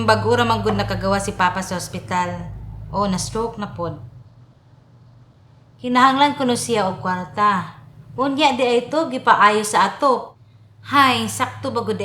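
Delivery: ordinary talk, nothing marked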